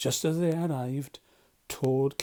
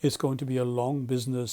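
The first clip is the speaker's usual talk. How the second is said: ordinary delivery, nothing marked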